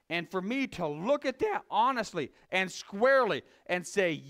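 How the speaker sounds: ordinary talk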